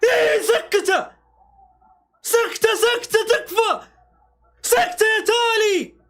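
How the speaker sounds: high, slow, desperate begging yell; real pleading yell at the screen; pleading shout. Real, not acted. Begging player to shoot.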